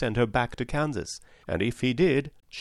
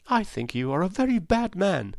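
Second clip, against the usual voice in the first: lightly voiced indignant Dorothy, higher; higher, a touch of scolding; reproachful girl; 8 semitones higher than usual. A narrator's reading. Dorothy scolds the humbug wizard, mildly indignant.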